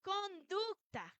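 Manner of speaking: slow, emphatic "Conducta"; slow emphatic answer; drawn-out key word. Shown as a demonstration. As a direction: stretch the word out for the class. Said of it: "¿qué dice allí?".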